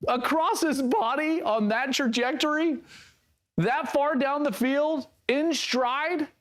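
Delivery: high, stunned disbelief; can't believe what you just saw; astonished. Real, not acted. Reacting to a great throw.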